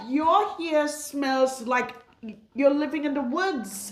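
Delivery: retold teasing scold to a client; retold blunt telling-off. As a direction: lay the blunt truth on her. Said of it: "I'm like".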